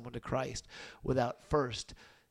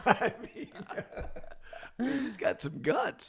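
laughing; 9 semitones higher than usual. Real, not acted. Laughing at short people joke.

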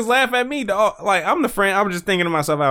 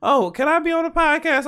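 high, eager little-kid imitation; little cousin; 13 semitones higher than usual. Little cousin asking to join the podcast, imitated high child voice. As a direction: plead in a put-on small child's voice. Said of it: "She's like".

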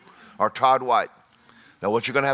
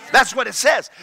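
ordinary delivery

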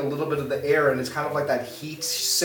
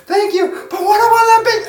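giddy villagers; 4 dB louder than usual. Acted. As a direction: gush with a giggle, then ask eagerly. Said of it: "They're like".

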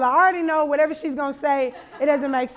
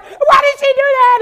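high, loud indignant yell; shocked by a stranger's rude gesture, high loud yell; outraged scream; 10 semitones higher, 11 dB louder than usual. Retold or imitated. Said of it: "we're just screaming".

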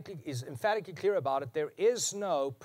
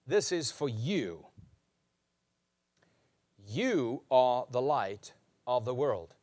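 slow, deliberate "You are the light of the world"; slow pointed declaration; 3 semitones lower, slower than usual. Acted. Preacher pointing at his hearers, slow and deliberate. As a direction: press each word home.

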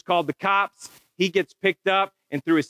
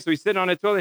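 ordinary delivery

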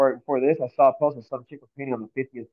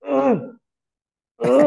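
high strained grunts of effort; straining groans; 11 semitones higher, 6 dB louder than usual. Retold or imitated. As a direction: groan like you're slipping off a wall. Friend straining, falling off ledge.

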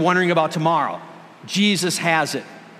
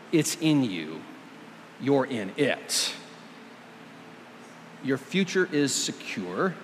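soft reassurance; 4 semitones lower, 7 dB quieter than usual. Real, not acted.

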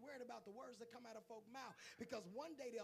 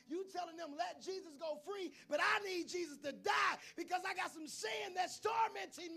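loud, higher preaching; 7 semitones higher, 12 dB louder than usual. Acted. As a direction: drive the point home from the pulpit. Preaching to the congregation.

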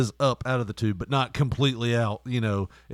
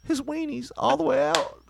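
upset daughter; 12 semitones higher than usual. Retold or imitated. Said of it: "She's like".

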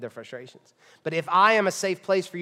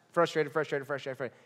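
repeated word fading softer and lower; trailing-off repetition; 4 semitones lower, 5 dB quieter than usual. Acted. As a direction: let the list of complaints peter out. Skims repeated complaint as filler.

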